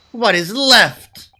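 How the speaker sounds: theatrical peak of despair; climactic wail. Acted. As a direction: stretch out a despairing howl. Climax of lament.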